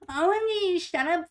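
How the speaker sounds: ordinary talk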